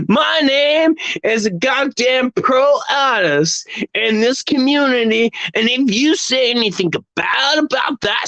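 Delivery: American accent impression. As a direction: overblown American showman accent. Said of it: "I can do the American accent".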